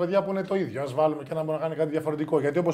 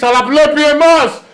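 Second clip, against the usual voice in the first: high, loud, drawn-out boast; playful shout-out; 7 semitones higher, 12 dB louder, slower than usual. Pretend. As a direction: crow it loudly at a rival. Jokey shout-out to rival host.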